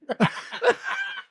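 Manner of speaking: high, shrieking laugh; real laughter cracking up high; high burst of laughter. Real, not acted. Laughing at the story.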